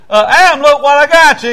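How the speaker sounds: high, loud booming presentation; God's proud announcement. Acted. God presenting Eve to Adam.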